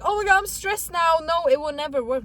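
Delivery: high, loud stressed-out fretting; mock panic. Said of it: "So don't be like".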